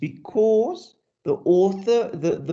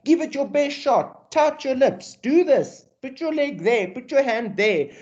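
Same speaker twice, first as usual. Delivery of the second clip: mock photographer; 8 semitones higher than usual. Mimicking photographer directing poses.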